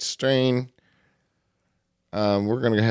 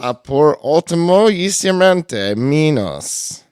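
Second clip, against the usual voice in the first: loud ring announcer, raised pitch; lucha libre announcer; 4 semitones higher, 7 dB louder, slower than usual. Pretend. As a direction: build to the name like a wrestling emcee. Introducing last cohost.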